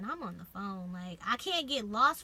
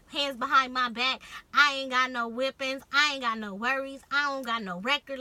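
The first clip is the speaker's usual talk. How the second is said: retold protest, louder and emphatic; loud insistence; 3 semitones higher, 9 dB louder than usual. Telling loss prevention to take her to the back, loud insistent protest. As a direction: press each point loudly, as in a heated plea.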